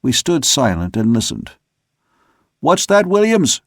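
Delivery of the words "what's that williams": high, carrying call aloft. Acted. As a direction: call it up into the dark. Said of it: "he sung out".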